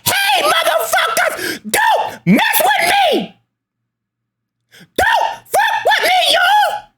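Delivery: a very high, slow mock scream; Lonnie screaming. Vlogger mimics the YouTuber suddenly yelling at her haters. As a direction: snap from chat straight to a shrieking yell, slowly. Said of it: "start screaming and yelling".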